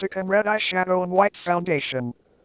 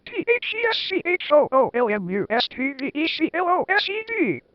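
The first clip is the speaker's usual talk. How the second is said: mock angry shout; 7 semitones higher than usual. A narrator's reading. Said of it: "she shouted angrily".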